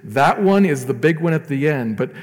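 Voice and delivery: loud, rising sermon climax; preaching at full force. Acted. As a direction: drive it home from the pulpit, full voice.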